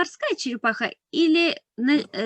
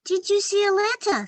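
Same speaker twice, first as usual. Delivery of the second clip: little mouse voice; 9 semitones higher than usual. Acted. Mouse character Penny asks.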